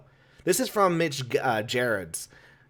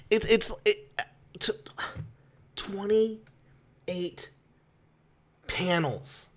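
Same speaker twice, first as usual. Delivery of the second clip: high, halting, stammered disbelief; stammer, then let the words out with long pauses; lost for words, voice raised in pitch; speechless disbelief; 4 semitones higher, 5 dB quieter, slower than usual. Real, not acted. Lost for words over count.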